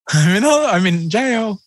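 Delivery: high, slow pleading confession; mock jailed daughter. Rehearsing the fake jail call to her dad, high and slow. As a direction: sell the jail story slowly. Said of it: "Then I'm gonna go".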